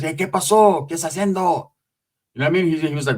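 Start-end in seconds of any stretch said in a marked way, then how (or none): 0.0-1.7 lightly voiced Spanish call across the tier; a hint of Puppet calling out in Spanish; voicing Puppet in Spanish. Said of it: "started speaking in Spanish".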